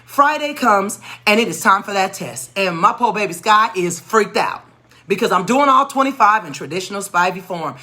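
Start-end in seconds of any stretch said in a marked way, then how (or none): none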